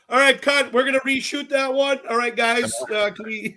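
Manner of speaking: high, loud director calling cut; hammed-up crew instructions; mock TV director. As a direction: call out to the set like a director. Imitates crew calling for reshoot.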